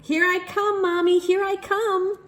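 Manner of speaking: high, loud calling out; excited child call. A narrator's reading. Little boy running to his mother, high and loud. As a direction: run in shouting with glee.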